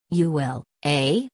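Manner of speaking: understated sneer on a challenge. A narrator's reading. Husband mocking wife's threat to go without him, sneering. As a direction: sneer it back as a doubting question. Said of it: "he sneered".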